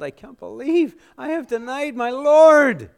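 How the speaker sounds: loud, high cry of disbelief; preacher voicing a stricken disciple, pitched high; anguished self-reproach. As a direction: cry out in acted remorse. Acting Peter's horrified realization of failure.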